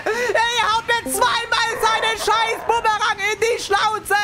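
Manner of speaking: very high-pitched rage screaming; frantic shouting. Real, not acted. Streamer hit by boomerangs near the finish, enraged screaming. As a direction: scream high, building from panic into fury.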